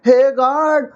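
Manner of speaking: high, drawn-out "oh my god" mimicry; mock dismay. Commentator mimicking the anchor's outcry, mock dismay. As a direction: stretch it out in high, hammed-up alarm. Mockingly echoes the anchor's exclamations.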